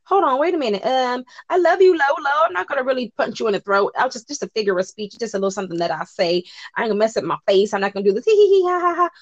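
high, hammed-up fake-nice Tamar voice; mock innocent backpedaling. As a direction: play her faking madness then laughing it off. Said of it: "She was like".

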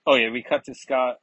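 usual speaking voice

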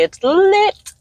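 high, loud catchphrase delivery; M Q impression. Podcaster quoting an Instagram friend, high catchphrase impression. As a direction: pitch way up and punch it out. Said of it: "as M Q would say".

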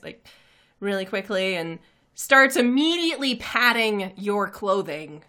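usual speaking voice

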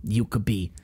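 low, soft mock warning in a put-on accent; instructor impression. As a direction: lightly imitate a cautioning instructor. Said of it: "He's like".

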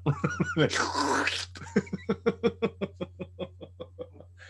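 long, high cackling laugh trailing off. Real, not acted. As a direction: crack up at your own joke. Cracks up at his own joke.

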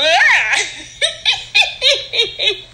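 high-pitched fit of giggles. Real, not acted. Cracking up over the odd noise, high giggle.

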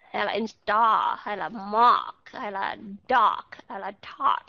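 star, mark, dark, tart slowly on an open O; model O words. Shown as a demonstration. Teacher drilling the O vowel in word examples. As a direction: drill each word on a long O. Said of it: "Ok, O".

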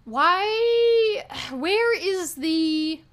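real frustrated tears. Real, not acted. Upset about online insults, near tears and slow. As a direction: voice cracking high, dragging slowly. Venting about hurtful comments, voice cracks.

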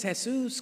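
"Jesus" said the Spanish way, as "heh-SOOS"; Spanish pronunciation. Acted. Preacher joking about God's name, "Jesus" pronounced Spanish-style as "heh-SOOS". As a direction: say "Jesus" with an h sound, stressed on the second syllable. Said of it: "I didn't know Jesus had an accent".

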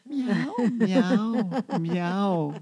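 high, screechy imitated meow; a cat meowing like an eagle. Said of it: "Il miaule comme un aigle".

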